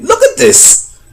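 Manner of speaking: very high-pitched, excited cry; amazed exclamation. Real, not acted. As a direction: squeal it in real astonishment. Amazed by what he found.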